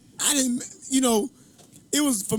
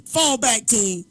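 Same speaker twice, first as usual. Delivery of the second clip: higher, louder mock court shout; calling out; 4 semitones higher, 4 dB louder, much slower than usual. Point guard orders his team back, calling out. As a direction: call out to teammates, re-enacting it.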